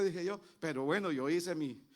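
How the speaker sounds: usual speaking voice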